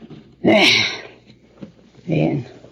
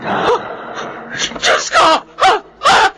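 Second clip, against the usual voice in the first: very high panicked shrieks; terrified screaming; 4 dB louder, slower than usual. Acted. Man who opened the door, shrieking in terror.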